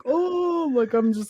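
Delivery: high-pitched drawn-out "ooh"; long, high mock "oh"; high carefree "oh". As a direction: re-enact a carefree, high "oh" while dangling. Acting out carefree dangling on ride.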